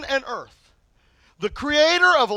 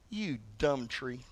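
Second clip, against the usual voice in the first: low muttered insult; 11 semitones lower, 14 dB quieter, slower than usual. Retold or imitated. Jesus scolds the tree.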